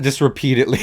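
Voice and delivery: ordinary delivery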